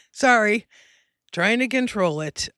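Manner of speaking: real emotion welling up, trying to control it; getting emotional. Real, not acted. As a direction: choke up briefly, then apologise and regain control. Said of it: "I'm getting emotional right now".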